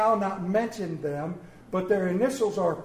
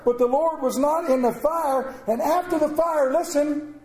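loud preacher reading, building higher; forceful scripture reading; 4 semitones higher, 4 dB louder than usual. Acted. Preacher reads of wind, earthquake and fire, forceful and building. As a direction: drive the storm verses upward.

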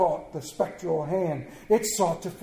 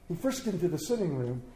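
ordinary delivery

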